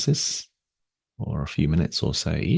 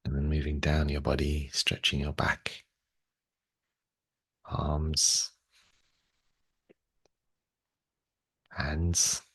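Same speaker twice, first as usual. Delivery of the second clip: unhurried, slightly lowered meditation voice, long pauses; guided meditation. Real, not acted. Guides body awareness and breathing.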